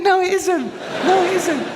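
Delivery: mock schoolboy insisting; shrill kid protest. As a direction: squeal it like a cocky schoolboy. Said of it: "I went".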